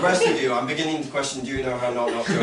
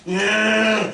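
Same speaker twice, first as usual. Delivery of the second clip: high, whiny-loud online crank voice; mock a conspiracy theorist's alarmed rant; 5 semitones higher, 3 dB louder than usual. Conspiracy theorists going online about the vaccine, mock ranting. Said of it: "hear the conspiracy theorists go online go".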